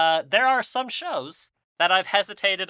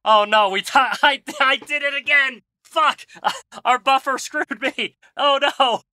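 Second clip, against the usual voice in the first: high, loud flustered laughing; panicked and cracking up at own mistake; 9 semitones higher, 6 dB louder than usual. Real, not acted.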